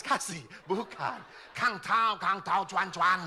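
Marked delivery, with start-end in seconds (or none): 1.5-3.2 loud mock Chinese-Indonesian trader voice. Priest mocking profit-seekers, loud mock trader accent. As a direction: chant the profit like a shopkeeper.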